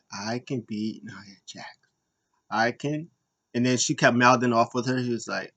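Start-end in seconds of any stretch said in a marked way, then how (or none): 0.1-3.1 timid repeat. Retold or imitated. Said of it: "And she's like".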